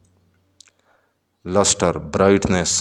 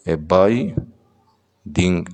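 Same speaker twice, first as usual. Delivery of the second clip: ए biding drawn out slowly; slow syllable demo; much slower than usual. Shown as a demonstration. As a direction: sound out ए biding beat by beat. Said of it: "दो syllable आ रहा है". ("ए biding" धीरे-धीरे, syllable अलग)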